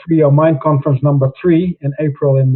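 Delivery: ordinary talk, nothing marked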